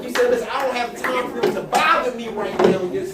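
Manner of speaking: preaching. Real, not acted. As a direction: drive the sermon home. Preaching at full force.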